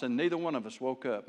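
ordinary delivery

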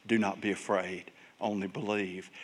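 low, soft remembered reassurance; quiet inner refrain; hushed echo. Acted. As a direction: let it fade like an echo in the mind. Words echo in father's mind.

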